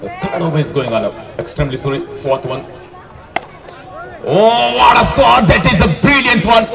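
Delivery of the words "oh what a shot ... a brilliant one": high, loud, drawn-out cry of delight; excited cricket commentary. Real, not acted. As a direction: roar it out, thrilled by the shot. Live commentary on big hit.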